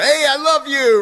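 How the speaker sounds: high, loud, careless shout; mock holler. Coach imitating someone who goes around blurting love to anyone, hollered high and loud. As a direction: belt it out like a caveman declaring love. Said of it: "scream it at the top of their lungs".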